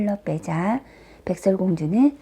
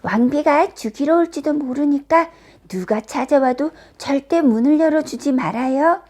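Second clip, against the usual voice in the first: higher-pitched, emphatic character warning; a dwarf's insistent caution; firm warning; 6 semitones higher, 3 dB louder than usual. A narrator's reading. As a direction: warn her earnestly, pitched up. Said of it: "단단히 일렀어요".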